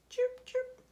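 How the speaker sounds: very high little chirps; high birdcall imitation; bird chirping. A narrator's reading. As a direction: pipe it up like a small bird. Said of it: "A bird".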